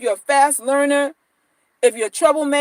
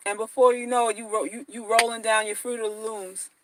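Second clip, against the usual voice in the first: ordinary talk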